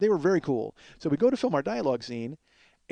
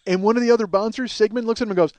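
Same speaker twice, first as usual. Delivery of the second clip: usual speaking voice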